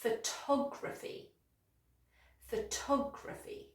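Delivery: PHOTOGRAPHY said slowly, softly as fuh-TOG-ruh-fee; soft first syllable, lean on TOG in "photography"; second-syllable stress. Shown as a demonstration. Teacher models the other noun, stress on second syllable. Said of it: "stress is on the second syllable".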